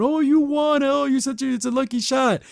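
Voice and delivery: high-pitched carnival man fawning over a winner; mock gushing praise. Mock praise of her lucky shot.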